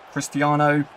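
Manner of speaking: the name called out high and loud; rising excited call of Cristiano. Real, not acted. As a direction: start the stadium chant. Name repeated louder as chant.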